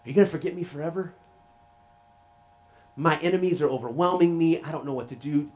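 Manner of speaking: a touch of anguish, with long pauses; pleading cry. Retold or imitated. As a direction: call out a retold plea to God. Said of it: "we hear these cries from a man".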